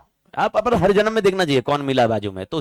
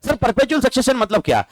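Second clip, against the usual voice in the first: a higher, questioning student voice asking what perpetual succession means; 7 semitones higher than usual. Pretend. Teacher plays student asking about perpetual succession, higher. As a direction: play the curious student, pitch up.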